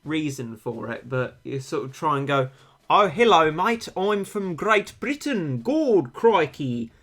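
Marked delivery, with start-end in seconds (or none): 2.9-6.9 American botching British, sliding into Australian; loud, higher, drawn-out fake British gone Aussie; bad British accent. Shown as a demonstration. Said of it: "my rendition of an American person trying to do an English accent".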